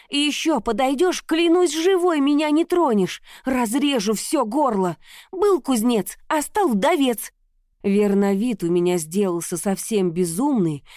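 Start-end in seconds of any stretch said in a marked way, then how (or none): 0.1-7.3 furious threat. A narrator's reading. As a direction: threaten him in a rage. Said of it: "Ярость переполняла меня".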